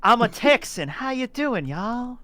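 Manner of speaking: high, drawled Texan greeting; Texas accent. Shown as a demonstration. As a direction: lay on a Texan twang.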